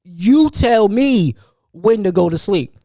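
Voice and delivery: slow, drawn-out indignant question; mock outrage, stretched out slowly; stunned disbelief. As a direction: ask it as if you can't believe a four-year-old said it. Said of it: "I'm used to going to sleep".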